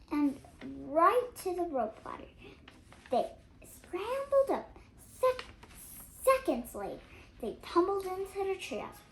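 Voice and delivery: loud, breathless chase narration; excited. A narrator's reading. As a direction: race through the escape.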